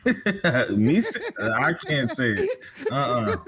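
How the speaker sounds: giggling too much to say it; laughing. Real, not acted. Challenged to do the Jamaican accent herself, giving up laughing.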